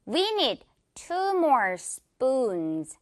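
slow English model sentence, "spoons" with a tense unaspirated p like Korean 뿌; every word drawn out, "spoons" said as "spuns" with a hard p; tensed p in spoons. Shown as a demonstration. Teacher models the sentence for Korean learners, slow and clear. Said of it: "이렇게 뿌 발음으로".

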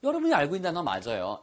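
ordinary talk, nothing marked

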